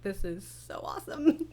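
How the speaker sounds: softly laughing through the words; quietly giddy with joy; laughing delight. Retold or imitated. Creator delighted hearing lines.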